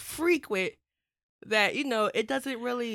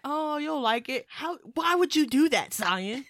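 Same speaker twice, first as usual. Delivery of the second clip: mock outraged mom, louder and higher; imitating mom scolding; 4 semitones higher, 6 dB louder than usual. Son mimicking his mom scolding his choice, mock outrage. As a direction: play the offended mother, hammed-up.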